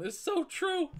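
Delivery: very high, loud, slow squeal; friend's squeal. Retold or imitated. The laughing friend backing up the speaker, in a high squeal. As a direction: stretch it out in a mocking squeal of agreement. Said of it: "just fucking going".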